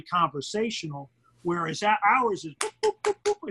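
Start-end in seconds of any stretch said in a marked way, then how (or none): 2.1-3.5 quick boops jumping much higher; rapid-fire boops. Shown as a demonstration. Announcer imitates the pace of his calls with quick boops. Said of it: "It's very rapid fire".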